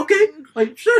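innocent agreement. Retold or imitated. Driver imitates his passenger agreeing to speed, innocent. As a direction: pitch it up, innocently trusting. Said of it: "he said it in the most innocent way".

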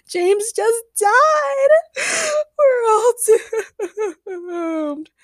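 high, slow wailing sobs; hysterical crying. A narrator's reading. Agnes believes James is dead, crying hysterically. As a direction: scream it through melodramatic tears. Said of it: "started crying and screaming hysterically".

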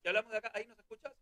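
faint distant voices; testing from far off; far from mic. Real, not acted. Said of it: "Lejos, estamos lejos". (lejos del micrófono)

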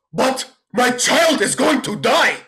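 high, slow melodramatic wail; overacted desperation. Bad actor protesting to the director, overacted desperate cry. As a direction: ham it up as a mock anguished cry. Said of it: "let's tone it down a little bit".

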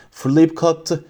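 plain reading voice

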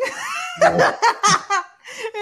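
loud, very high burst of laughter; high squealing laugh. Real, not acted. Cracking up at own impression of girls, squealing laugh.

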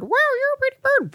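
very high squeaky parrot voice; high-pitched bird impression; parrot mimicry. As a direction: echo it back like a pet bird.

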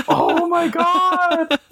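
high, slow, drawn-out groan of disbelief; a teen daughter groaning oh my god; exasperated daughter. Retold or imitated. Father tells of his daughter's reaction, high and drawn out.

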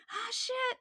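high-pitched mock burst of fond surprise; playfully voiced pleased surprise; delighted recognition. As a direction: imitate someone happily spotting an old favourite. Acting out professor's fond recognition.

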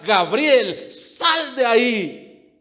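loud, high shout with long-held words; commanding cry. Acted. Preacher imagining the Lord calling him from death, commanding cry. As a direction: cry it out slowly as a summons. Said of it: "el grito del Señor".

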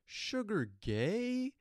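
high, loud, drawn-out mockery; stretched, swooping mocking question; kid's taunt. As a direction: imitate a kid jeering slowly. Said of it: "this kid just goes".

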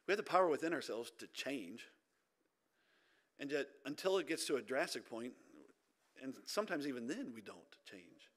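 ordinary talk, nothing marked

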